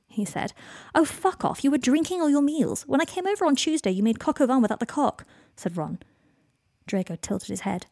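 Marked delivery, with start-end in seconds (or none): 0.9-5.3 high-pitched indignant protest; indignant banter. A narrator's reading. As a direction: fire back at a friend, voice jumping high. Ron banters back, swearing, at Draco.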